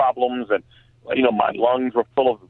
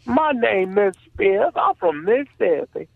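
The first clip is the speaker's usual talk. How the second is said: put-on Southern accent; 10 semitones higher than usual. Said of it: "Missus Spears ... Southern Baptist ... from Mississippi".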